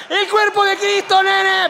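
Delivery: un toque de papa argentino relajado; papa buena onda. Acted. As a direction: reparte la comunión como un cuate. El Papa da la comunión. (el Papa dando la comunión, en tono cool)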